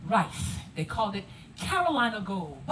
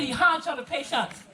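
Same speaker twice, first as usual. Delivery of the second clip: a phrase in thick Gullah, raised and running together; Gullah dialect; 4 semitones higher, 4 dB louder, slower than usual. Shown as a demonstration. Guide repeating the coded warning about Jill, Gullah dialect. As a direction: say it the Gullah way, words blurred together. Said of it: "Her hand is short of patience".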